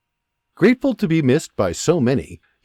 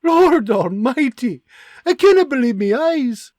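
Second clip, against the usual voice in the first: crying; 12 semitones higher, 5 dB louder than usual. Acted. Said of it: "as she starts to cry".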